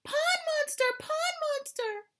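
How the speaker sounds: high frantic warning cry; panicked cry. Acted. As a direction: shriek the warning. Fleeing in panic.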